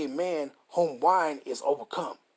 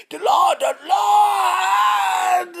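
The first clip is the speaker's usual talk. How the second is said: drawn-out, much higher and louder mock preacher cry; 10 dB louder, much slower than usual. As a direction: ham up the preacher, stretching each Lord. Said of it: "ain't that dude always say".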